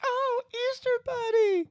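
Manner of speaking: high cartoon squeal; cartoonish high voice. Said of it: "They're like".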